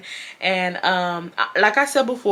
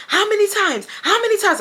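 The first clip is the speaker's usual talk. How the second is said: much higher, exasperated mock yell; mock caps yelling; 13 semitones higher than usual. Imitating Zebra's all-caps texts, much higher mock yell. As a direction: act out a message typed in all caps. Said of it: "she's typing in caps".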